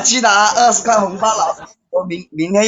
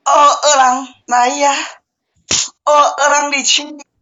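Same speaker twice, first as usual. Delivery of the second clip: higher-pitched mock-pleading cries; put-on moaning calls; 7 semitones higher, slower than usual. Forfeit player pleading with viewers by nickname, hammed-up moaning. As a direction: wail each name with hammed-up longing.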